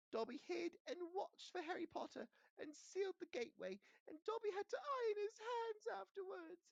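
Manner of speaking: very high squeaky elf voice; a loyal servant confessing; Dobby owns up to Harry, squeaky and earnest; eager house elf. Acted.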